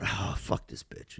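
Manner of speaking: unvoiced, soft and slow grumble; under his breath. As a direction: say it to yourself, fed up with waiting. Muttering while on hold.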